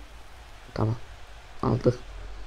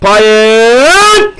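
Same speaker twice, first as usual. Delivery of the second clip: shouting; 7 semitones higher, 11 dB louder than usual. Real, not acted. Cheering player's name.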